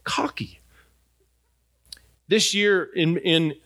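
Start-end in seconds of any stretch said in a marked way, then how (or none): 0.0-0.6 teasing wife whisper. Retold or imitated. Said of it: "She would say".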